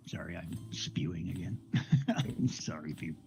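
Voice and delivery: soft unvoiced whisper of an embarrassed apology. Real, not acted. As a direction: breathe the apology out, with no voice. Apologizing under breath after rant.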